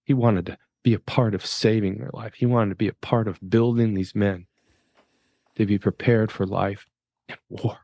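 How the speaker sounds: moved. Real, not acted. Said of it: "it's hard for me to get through that".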